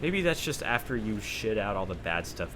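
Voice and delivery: usual speaking voice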